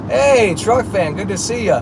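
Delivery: high, drawn-out hello to a fan; cheerful greeting. Real, not acted. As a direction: greet the viewer with a big lift. Greeting a viewer on stream.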